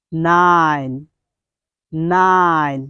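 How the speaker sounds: নাইন drawn out with a long ai; American nine. Shown as a demonstration. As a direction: stretch the vowel in নাইন. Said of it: "আজকে থেকে আপনি বলবেন".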